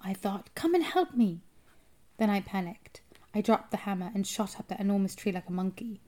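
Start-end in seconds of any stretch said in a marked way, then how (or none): 0.6-1.4 high-pitched anxious plea; frightened plea. A narrator's reading. Frightened boy wishing for his grandmother, pleading. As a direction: beg for rescue, voice raised high.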